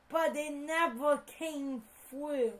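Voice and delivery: usual speaking voice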